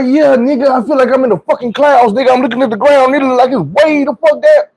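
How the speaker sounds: high, wide-eyed wonder; floating in the clouds and loving it; giddy amazement. Retold or imitated. Telling his friends how high he feels, high giddy wonder.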